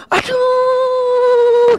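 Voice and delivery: very high, long drawn-out squeal; excited squeal. Retold or imitated. Women meeting a long-lost friend, excited squeal. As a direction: imitate an overjoyed squeal, stretched right out. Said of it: "itu bisa gini".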